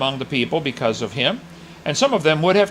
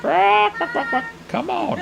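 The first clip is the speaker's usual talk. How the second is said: a squawky, much higher chicken-like "Come on"; mock chicken. Preacher mocks professors who won't come, as a squawking chicken. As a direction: cluck it out like a taunting chicken. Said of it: "what's that noise the chicken makes?".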